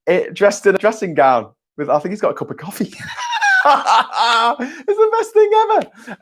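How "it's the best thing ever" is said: high laugh into slow gushing words; real laughter, much higher; delighted laugh. Real, not acted. Laughing at Soprano statue.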